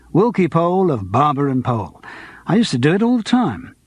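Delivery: plain reading voice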